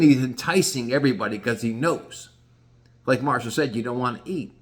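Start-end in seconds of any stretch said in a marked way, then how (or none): none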